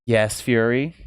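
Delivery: ordinary delivery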